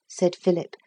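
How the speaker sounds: usual speaking voice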